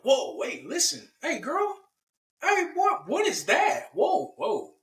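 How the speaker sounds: high-pitched, startled double take; startled alarm. Acted. Adam and Eve see they are naked, startled. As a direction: yelp in mock shock at the sight. Voicing shock at suddenly seeing nakedness.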